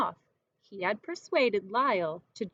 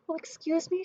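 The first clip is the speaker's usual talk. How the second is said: high, anxious little plea; a fretful lady voice; flustered lady; 7 semitones higher than usual. Acted. Missus Prim asking the information lady, flustered.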